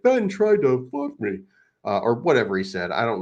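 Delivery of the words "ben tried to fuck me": high, slow put-on whine; high-pitched mocking complaint; mock complainer. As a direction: mimic him in a high, dragged-out voice. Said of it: "And he's like".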